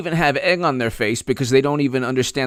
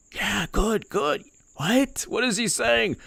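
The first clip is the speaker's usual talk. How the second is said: mock supporter; 6 semitones higher, 7 dB quieter, slower than usual. Mock supporters cheering quietly.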